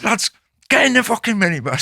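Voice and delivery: mild mock yell to the lads; retold urgent call. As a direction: re-enact hurrying your mates along, pitch up. Retold urgent order to mates.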